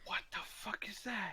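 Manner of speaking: a sudden hushed whisper, breath only. Real, not acted. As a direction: mutter it under your breath in disbelief. Startled reaction, muttered aside.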